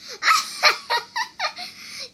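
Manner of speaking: very high cackling laugh; loud, high staccato mocking laugh; villainous laugh. A narrator's reading. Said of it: "The woman laughed".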